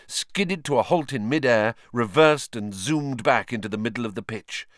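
ordinary delivery, nothing marked

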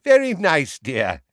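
high, loud forced brightness; falsely cheery. A narrator's reading. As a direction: sound cheerful but not mean it. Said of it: "in a falsely cheery voice".

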